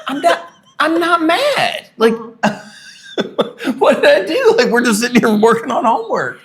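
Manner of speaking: high laughing protest; laughing disbelief. Retold or imitated. Father laughing, baffled by daughter's question about being mad.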